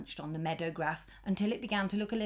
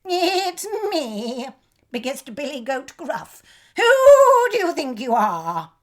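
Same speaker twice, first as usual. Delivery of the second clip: high, bold goat voice; high confident goat call; confident bleat; 8 semitones higher, 14 dB louder, slower than usual. A narrator's reading. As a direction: bleat it out bravely. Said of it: "his most confident bleat".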